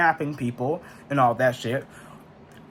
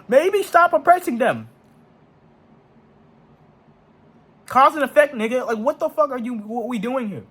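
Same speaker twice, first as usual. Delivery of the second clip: exasperated; 7 semitones higher than usual. Real, not acted. Ranting at people justifying killings.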